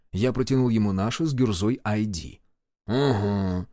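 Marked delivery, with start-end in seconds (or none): 2.9-3.7 low, grunted "uh-huh"; curt low mumble; grunted assent. A narrator's reading. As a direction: grunt it, pitched down. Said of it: "буркнул он".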